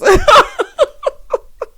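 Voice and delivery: high, loud burst of laughter; real high-pitched cackle; laughing. Real, not acted. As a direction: crack up helplessly. Cracks up at sister sisters.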